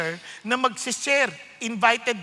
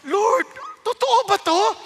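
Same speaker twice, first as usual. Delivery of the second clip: stunned disbelief; 8 semitones higher, 6 dB louder than usual. Retold or imitated. Stunned plea to God.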